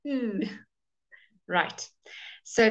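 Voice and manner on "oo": long "oo" with lips pushed out; fish-lipped oo. Shown as a demonstration. Teacher shows students fish lips for oo, rounded. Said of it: "You must look like a, a, a fish".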